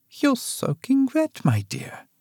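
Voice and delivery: high, slow motherly voice; a lightly voiced old mother; elderly mother, fond. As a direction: greet a soaked daughter gently.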